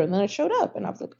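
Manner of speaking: ordinary talk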